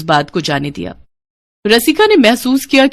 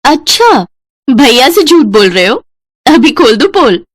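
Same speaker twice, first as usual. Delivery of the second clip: loud, higher mock scolding; raised-voice teasing challenge; teasing threat; 5 semitones higher, 6 dB louder than usual. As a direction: playfully threaten to tell on him. Mock threat to expose him.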